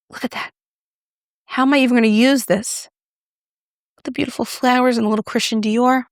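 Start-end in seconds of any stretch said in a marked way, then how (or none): none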